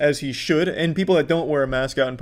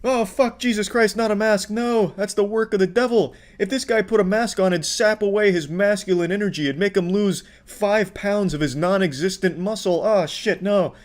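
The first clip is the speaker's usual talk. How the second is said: hammed-up dread in a raised pitch; mock horror; 4 semitones higher than usual. Commentator mocking a man's fear of masks, hammed-up horror. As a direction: feign panic, high and overdramatic.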